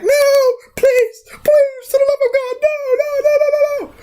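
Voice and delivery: very high squealing plea; hammed-up begging. Threatened character begging for mercy, mock-terrified wail. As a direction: plead frantically in a squeaky, crying falsetto.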